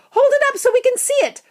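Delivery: quick, high, loud crowd yell; shouting. A narrator's reading. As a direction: call it out fast over a crowd. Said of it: "someone shouted".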